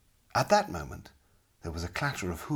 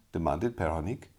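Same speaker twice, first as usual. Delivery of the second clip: plain reading voice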